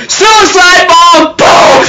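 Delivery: high slow shouted outburst, drawn out; excited shout. Retold or imitated. Viewer reacting to a grenade hug, shouting. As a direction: yell it like the big reveal. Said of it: "I go".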